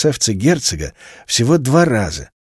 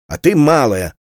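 mild snap; 3 semitones higher than usual. A narrator's reading. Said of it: "огрызнулся Жюль".

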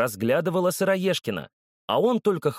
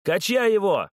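cheering shout; 8 semitones higher, 3 dB louder than usual. A narrator's reading. Crowd cheering the boy.

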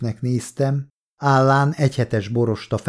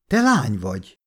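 a mild startled blurt; understated astonishment, words escaping before thought; 2 semitones lower than usual. A narrator's reading. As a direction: let it slip out in surprise. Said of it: "bökte ki Kólya".

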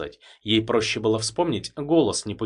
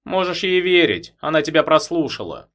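captain's voice; 6 semitones higher, 6 dB louder than usual. A narrator's reading. Sorokin reassures.

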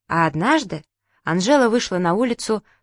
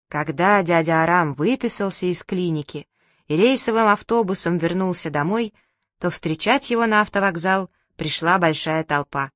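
plain reading voice

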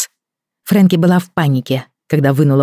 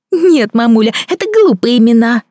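put-on indignant little boy; 9 semitones higher, 4 dB louder than usual. A narrator's reading. Child rejects silly names.

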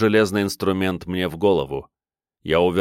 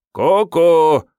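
high, loud singsong cuckoo call; cheerful cuckoo call; 10 semitones higher, 7 dB louder than usual. Acted. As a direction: chirp it out like a mocking cuckoo. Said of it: "весело крикнула она". (весёлый крик кукушки)

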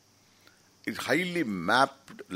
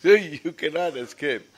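laughing; 4 semitones higher, 5 dB louder than usual. Real, not acted. Still laughing at his point.

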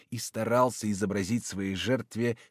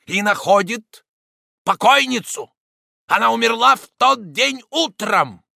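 dramatic storyteller; 13 semitones higher, 12 dB louder than usual. Acted. Roden tells dramatic death tale.

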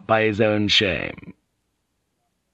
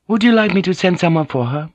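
put-on polite young woman; 9 semitones higher, 3 dB louder, faster than usual. Fujiko offering, eager to please.

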